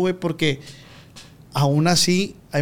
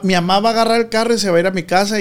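usual speaking voice

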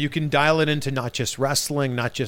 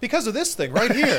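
laughing retake; 8 semitones higher than usual. Acted. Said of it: "what kind of voice was that?".

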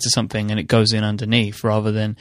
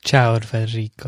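put-on Italian accent. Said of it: "practice your Italian accent".

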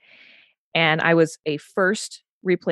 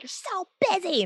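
very high imitation of an angry TV heroine; mock-angry outburst; 3 dB quieter than usual. Angry New Yorker to whoever is in her way, mock-angry outburst. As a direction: squeal it in mock New York outrage. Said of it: "you're just like".

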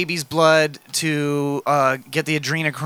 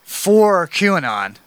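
slow dramatic reveal; much slower than usual. Real, not acted.

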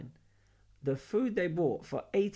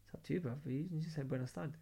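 hushed; 10 dB quieter than usual. Retold or imitated. Whispering at the bar.

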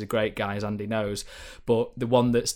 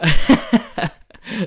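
a burst of embarrassed laughter; short real laugh, pitch jumping up; 9 semitones higher, 9 dB louder than usual. Real, not acted. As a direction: laugh at your own bad impression. Laughs at own bad accent.

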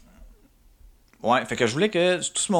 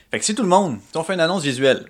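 ordinary talk, nothing marked

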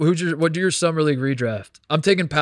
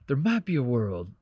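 a light put-on imitation of Bill's accent; mild mock version of Bill's accent; 4 dB quieter than usual. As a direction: slip into Bill's accent for the quote. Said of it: "I'm gonna stop with the accent".

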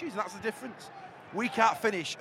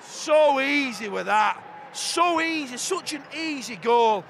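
exasperated; 6 semitones higher, 6 dB louder, much slower than usual. Real, not acted. Goal conceded, exasperated.

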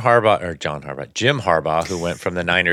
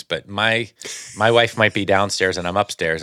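normal talking voice

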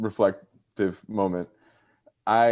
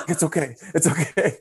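a hint of a grandma soothing, a bit higher; 6 semitones higher than usual. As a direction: hush and calm her down. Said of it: "She's like".

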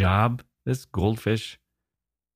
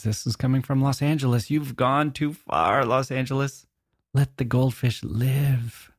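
mock scolding; 6 semitones higher than usual. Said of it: "And I thought".

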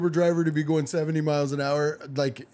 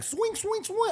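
mock driver cry; 6 dB quieter, slower than usual. Imitating driver swerving.

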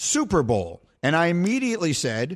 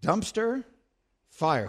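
slow mulling; 3 dB quieter, much slower than usual. Real, not acted. Host repeats answer, mulling.

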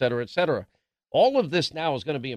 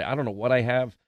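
usual speaking voice

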